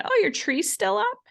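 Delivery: lightly voiced cleaner's passive-aggressive remark; mock passive-aggressive. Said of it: "made a passive-aggressive comment".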